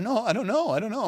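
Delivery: high-pitched mock nervous denial; shaky scared voice. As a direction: imitate a kid's uncontrollable little wobble. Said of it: "That voice starts kicking in".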